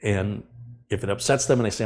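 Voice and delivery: ordinary talk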